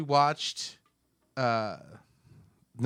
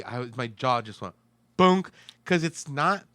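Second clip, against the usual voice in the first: ordinary delivery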